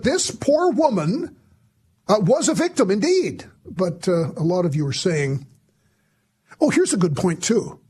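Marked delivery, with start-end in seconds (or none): 0.0-3.4 high, sing-song mock sympathy; sarcastic pity. Mocking claim she was victim.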